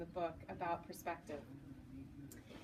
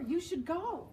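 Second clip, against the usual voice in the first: high, eager urging; excited boyfriend; 8 semitones higher, 4 dB louder, slower than usual. Retold or imitated. Boyfriend urging her to the game, excited and high. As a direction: push her to go, thrilled.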